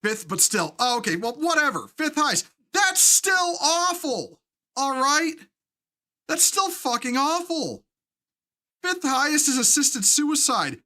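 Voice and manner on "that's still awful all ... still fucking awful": slow, high, stretched outrage; a disgusted, stretched-out complaint; drawn-out outrage. Real, not acted. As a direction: lean hard and slow into awful. Outraged at the suicide figure.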